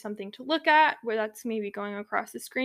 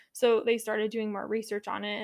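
ordinary talk, nothing marked

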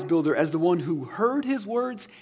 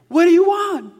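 much higher, louder irritated demand; mock annoyed shout; 12 semitones higher, 11 dB louder than usual. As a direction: bark it like a fed-up teenager. Kid finally reaching parents, annoyed.

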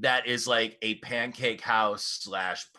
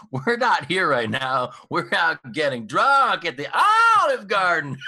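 outgoing message voice; 7 semitones higher, 9 dB louder than usual. Retold or imitated. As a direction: read it like a cheesy voicemail greeting. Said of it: "the message on the outgoing message is like".

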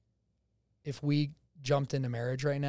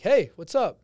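higher, louder phone hello; upbeat retold greeting; 6 semitones higher, 8 dB louder, slower than usual. Young man retelling his call to a girl, upbeat greeting. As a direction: breeze in with a confident greeting.

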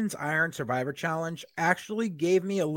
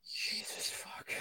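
whispered curse; 15 dB quieter, much slower than usual. Real, not acted. Exasperated aside at bad booking.

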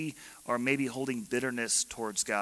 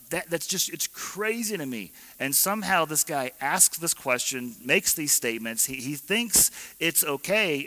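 normal talking voice

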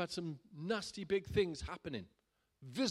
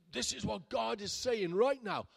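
slow and loud, higher, proclaiming; loud prophetic voice; 7 semitones higher, 6 dB louder than usual. Retold or imitated. Stranger on a train prophesying loudly over the pastor. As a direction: announce it like a prophet over someone. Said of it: "and in a loud voice".

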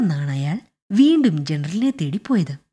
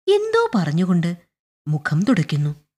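plain reading voice

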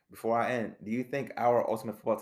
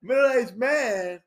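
much higher, slow, hammed-up parody of the coach; mock Pacino attempt; 13 semitones higher, 6 dB louder, slower than usual. Host mocking the coach's lament for his guest, high and slow. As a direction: squeak out the coach's lament as a joke. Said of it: "I can't".

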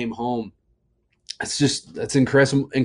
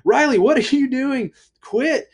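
alarmed dad; 13 semitones higher than usual. Retold or imitated. Dad seeing crayons on the kitchen table, alarmed. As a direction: catch her mid-crime in alarm. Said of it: "not Natalie".

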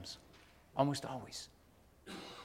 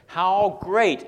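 "How great" high and loud, as an exclamation; 5 semitones higher, 7 dB louder than usual. Shown as a demonstration. Preacher showing the verse's exclamation point, exclaimed. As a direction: exclaim HOW GREAT, both words punched. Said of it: "It ends with an exclamation point".

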